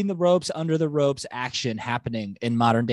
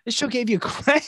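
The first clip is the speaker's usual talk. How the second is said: laughing; 9 semitones higher than usual. Real, not acted. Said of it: "laughs while repeating".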